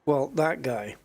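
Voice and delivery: ordinary delivery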